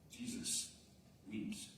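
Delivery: soft, near-whispered and slow; hushed reverence. Real, not acted. Preacher reciting the shortest verse to the church, hushed. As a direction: let it land in hush.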